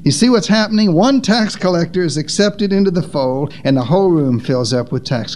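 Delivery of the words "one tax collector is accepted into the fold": ordinary talk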